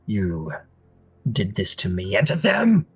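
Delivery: rising anger. Acted. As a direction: begin controlled, then swell into fury. Prince's accusation builds to anger.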